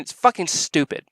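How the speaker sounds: ordinary talk, nothing marked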